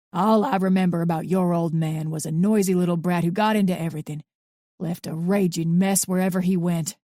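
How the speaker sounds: lightly voiced bitter old man, scornful; a hint of a cranky old man sneering; gruff old man. A narrator's reading.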